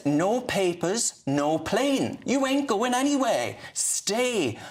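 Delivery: ordinary talk, nothing marked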